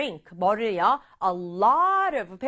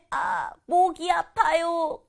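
put-on slow, raised sick-kid voice with a hoarse complaint; sick child whining; 6 semitones higher, slower than usual. Teacher voicing a sick child answering mom, slow and whiny. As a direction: whine it out like a kid with a sore throat. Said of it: "내 대답은".

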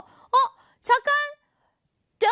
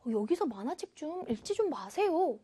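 softer, quick and gentle appeal; soft polite request; 6 dB quieter, faster than usual. Shown as a demonstration. Teacher showing how please softens it, soft and polite. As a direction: ask kindly, with a hushed voice. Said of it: "부드러운 느낌이 되죠".

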